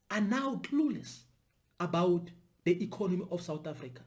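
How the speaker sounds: ordinary talk, nothing marked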